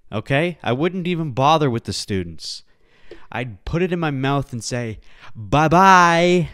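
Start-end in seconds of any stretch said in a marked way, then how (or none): 5.3-6.5 louder sing-song bye-bye; cheery fake goodbye; loud mock-cheerful bye-bye; mock farewell. Mock suicide joke farewell.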